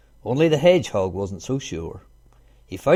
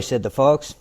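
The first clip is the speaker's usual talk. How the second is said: plain reading voice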